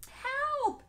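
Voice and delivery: put-on girl screaming for help; cry for help. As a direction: shout in panic. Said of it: "she called".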